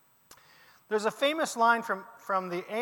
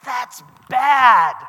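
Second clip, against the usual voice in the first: put-on loud, high teen slang cry; a high, loud slang "That's bad"; slang exclamation; 6 semitones higher, 18 dB louder than usual. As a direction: belt it out like a high-schooler. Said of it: "if I said".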